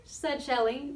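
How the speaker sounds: plain reading voice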